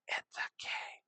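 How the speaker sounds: a hushed, breathy it's okay; whispered reassurance. Real, not acted. Narrator soothing himself about the messy border, in a whisper. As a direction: whisper it to calm yourself.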